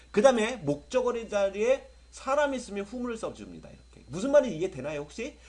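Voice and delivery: normal talking voice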